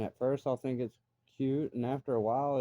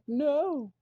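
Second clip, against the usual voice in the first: much higher and louder put-on voice; a dopey little squeak of a no; mock helpless answer; 4 dB louder than usual. Mocking the stranded driver who never looked for a jack, high dopey voice.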